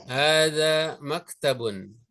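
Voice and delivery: Hadza baitun drawn out slowly, syllable by syllable; a teacher modelling Arabic for students to repeat; slow model pronunciation. Shown as a demonstration. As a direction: say Hadza baitun slowly so the class can copy. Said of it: "Baik, sama-sama".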